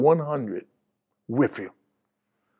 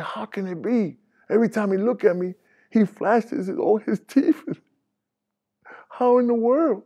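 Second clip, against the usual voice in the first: incredulous; 8 semitones higher than usual. Retold or imitated. Retold disbelief at friend's news.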